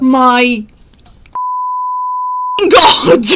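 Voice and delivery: exasperated "oh my God" breaking into laughter; incredulous laughing. Real, not acted. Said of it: "I'm laughing 'cause I can't believe".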